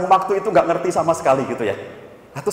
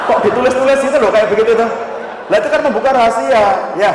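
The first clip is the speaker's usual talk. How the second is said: high, loud incredulous scolding; mock exasperation; 8 semitones higher, 5 dB louder than usual. Counsellor mocking husband who wrote a diary, mock exasperation. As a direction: scoff loudly at a silly blunder.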